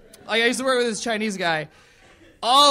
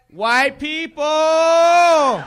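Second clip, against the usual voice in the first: higher, loud, very slow punchline shout; 7 semitones higher, 7 dB louder, much slower than usual. Acted. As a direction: bellow the answer out, dragging it.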